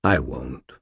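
low-pitched gentle reply; calm, tender admission; gentle refusal. A narrator's reading. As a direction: let it down gently, voice dropped low. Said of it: "said in a gentle voice".

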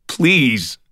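high, loud, drawn-out plea; desperate plea. A narrator's reading. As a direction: beg with everything you have. Desperate plea for his daughter.

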